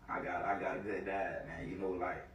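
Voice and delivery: usual speaking voice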